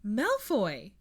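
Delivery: a moderate holler into the dark. A narrator's reading. Hermione hollering for Malfoy while stepping cautiously, a moderate holler. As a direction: call his name out as you edge forward. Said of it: "she hollered".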